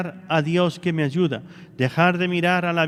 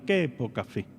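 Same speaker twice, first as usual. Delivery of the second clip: Jesus' gentle rebuke; 5 semitones lower, 6 dB quieter than usual. Retold or imitated. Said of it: "lo agarró y le dijo".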